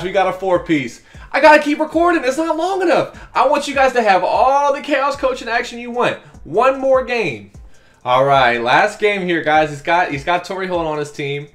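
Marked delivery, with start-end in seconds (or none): 1.3-7.5 high, excited pitch to viewers; buzzing with energy; hyped. Real, not acted. Gamer telling viewers, hyped, that he's recording more.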